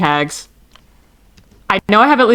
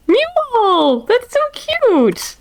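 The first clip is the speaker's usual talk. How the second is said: much higher, slow delighted cry; excited squeal; much slower than usual. Real, not acted. Seeing the newborn mule, high excited squeal.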